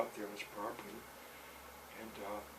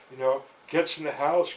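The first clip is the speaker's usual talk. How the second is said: ordinary talk, nothing marked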